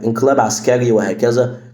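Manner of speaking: ordinary talk, nothing marked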